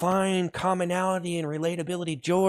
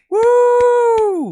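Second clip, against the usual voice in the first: very high, loud woo; explosive hype whoop; loud whooping cheer; 9 dB louder than usual. Real, not acted. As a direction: burst into a piercing whoop. Cheering the stream.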